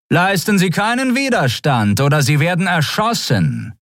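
high, monotone official warning; Skria officer's announcement. A narrator's reading. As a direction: issue the threat as a high-pitched announcement. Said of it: "erklärte die Skria-Stimme". (hohe Durchsagestimme mit Drohung)

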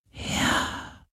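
very soft breathy whisper; breathed yes. Acted. Sea ghost breathes agreement to Galen, whispered. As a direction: barely exhale the word. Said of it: "hauchte der Geist des Meeres".

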